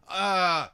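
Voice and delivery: loud, high groan of frustration; exasperated groan. Real, not acted. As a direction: groan loudly in real exasperation. Exasperated after failing to help patient.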